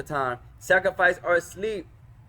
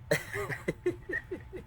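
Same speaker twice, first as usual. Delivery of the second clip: high laugh; 13 semitones higher, 12 dB quieter than usual. Real, not acted. Laughs at student's accent.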